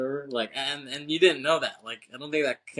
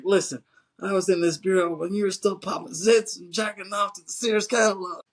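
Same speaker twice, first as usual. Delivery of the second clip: higher, a hammed-up tough-guy boast; mock tough agent; 7 semitones higher than usual. Old agent taunting the younger one, mock tough boast. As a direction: sneer it like a cocky old agent. Voicing Busey's comeback.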